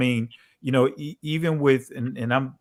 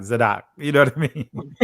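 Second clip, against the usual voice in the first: "Zadok" crammed as z'DOK in a Jersey accent; Jersey accent, first syllable swallowed in "Zadok". Shown as a demonstration. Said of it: "I got my little Jersey accent".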